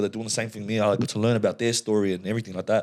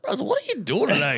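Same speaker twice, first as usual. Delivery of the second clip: much higher, laughing, teasing disbelief; mock incredulous; 13 semitones higher than usual. Mocking Gold Coast strollers to his mate, high and giggly.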